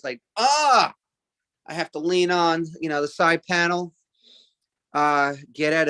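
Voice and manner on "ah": sharp high grunt of effort; get-out-of-car groan. Retold or imitated. Comedian showing his car-exit grunt. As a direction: groan like a stiff body leaving a car. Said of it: "I have a get out of the car noise".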